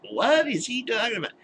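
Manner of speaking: baffled, what on earth; incredulous. Real, not acted. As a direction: pitch shoots way up in disbelief. Incredulous reaction to scripture.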